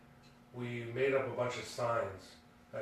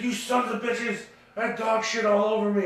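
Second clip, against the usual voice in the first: higher, louder imitated rant; furious cursing; 9 semitones higher, 7 dB louder than usual. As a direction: curse the kids who got you. Ronnie cursing the pranksters.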